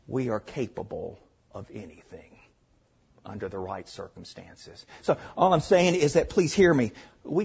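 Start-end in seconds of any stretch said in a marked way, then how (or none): 0.1-4.9 hushed warning. Real, not acted. Preacher drops to hushed warning.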